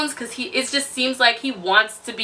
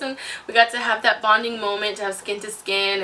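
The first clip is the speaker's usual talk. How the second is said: ordinary delivery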